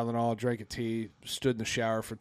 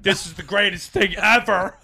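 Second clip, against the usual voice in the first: louder, laughing, overjoyed; hammered and thrilled; drunk delight; 7 semitones higher, 8 dB louder than usual. Retold or imitated. Drunk friend Tom quoted.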